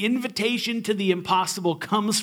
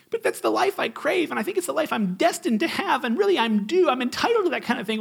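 high, fast, whiny rant with laughs; hammed-up sulky teenager; mock whining teen; 9 semitones higher, faster than usual. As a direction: gush out entitled complaints. Whining voice continues, faster.

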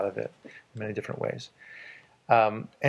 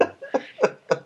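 laughing; 11 semitones higher than usual. Real, not acted.

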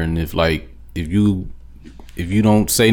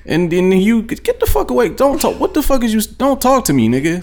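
much higher, heated telling-off; angry retort; 11 semitones higher than usual. Retold or imitated. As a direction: tell the stranger off, voice high and sharp.

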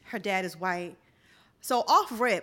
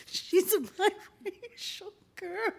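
slow, giggly, higher voice; laughing; 10 semitones higher, slower than usual. Real, not acted. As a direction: say it while cracking up. Giggling at the quote.

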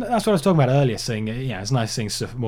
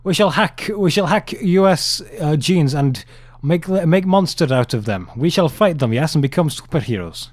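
Putin impression; 4 semitones higher than usual. Co-host imitating Putin plotting to become Ultra, hammed-up Russian accent. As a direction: lay on a hammy Russian strongman voice. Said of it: "you're just gonna have to do Putin monologues".

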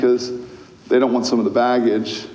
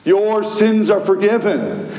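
preaching; 7 semitones higher, slower than usual. Acted. Pastor to a confessing man, declaring with authority. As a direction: proclaim it from the pulpit.

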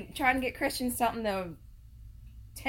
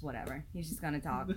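low, quiet muttered resignation; resigned; 8 semitones lower, 12 dB quieter than usual. Retold or imitated. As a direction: say it softly, having given up. Giving up on husband's chatting.

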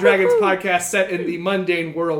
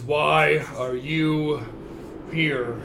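low, very slow ogre; slow menacing ogre; 3 semitones lower, much slower than usual. Acted. Ogre interrogates them.

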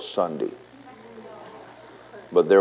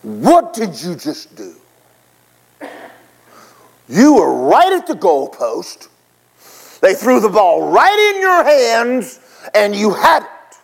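angry coach; 12 semitones higher, 5 dB louder, slower than usual. Retold or imitated. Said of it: "the coach looks at you and goes".

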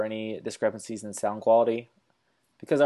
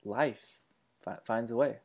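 soft, halting Goldblum line; a quiet, stammering Jeff Goldblum; Jeff Goldblum impression; 6 dB quieter than usual. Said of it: "I wanted Jeff Goldblum to just be like".

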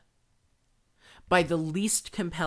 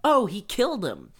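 high, loud schoolyard announcement; hammed-up kid bragging, higher and louder; gleeful kid; 8 semitones higher, 8 dB louder than usual. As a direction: blurt out the news to classmates. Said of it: "he's like".

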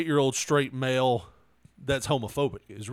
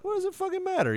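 exasperated retort; much faster than usual. Retold or imitated. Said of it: "I was like".

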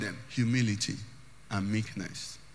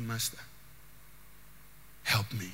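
soft plea; 7 semitones lower, 12 dB quieter than usual. Acted. Imagined Judas plea, soft.

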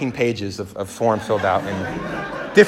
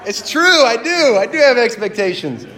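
high, loud mock wail; anguished realization; 9 semitones higher, 7 dB louder, slower than usual. Groom admitting to his mentor he has expectations, loud dismay. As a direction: cry it out in comic dismay, drawing it out.

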